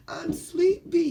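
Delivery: high, drawn-out sleepy voice; sleepy character. Acted. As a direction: whine sleepily, stretching the words. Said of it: "now he's yawning 'cause he's tired".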